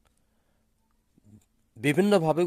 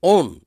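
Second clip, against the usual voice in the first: ওন said alone, high and loud; stressed syllable; 6 semitones higher, 9 dB louder than usual. Shown as a demonstration. Teacher isolates the stressed syllable ওন for pupils. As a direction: hit ওন hard. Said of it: "এর ওপর জোর দেয়া হয়েছে".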